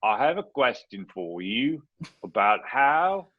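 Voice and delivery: hammed-up, slow Cockney caller; Cockney accent. Caller asking a question at office hours, mock Cockney accent. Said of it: "in a Cockney accent".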